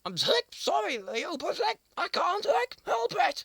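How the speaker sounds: high, loud squeaky complaint; high squeaky plea. Acted. Squirrel Monkey wailing to Leo about hiccups, high squeak. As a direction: wail it up high like a small monkey.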